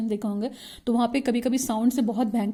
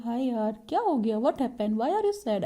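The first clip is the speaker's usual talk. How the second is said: softer, falling "why are you sad"; low, sad concern; 4 dB quieter, slower than usual. Shown as a demonstration. Teacher showing how to address a sad friend, subdued. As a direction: soften "हाय यार" into gentle concern. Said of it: "लो हो जाती है".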